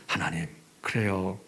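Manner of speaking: low hushed yielding; soft surrender. Retold or imitated. Praying person accepting God's word, hushed. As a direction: yield quietly to God.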